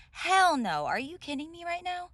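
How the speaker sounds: loud, high disbelieving yell; outraged shout. Acted. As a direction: explode in outrage. Outraged at sharing a room.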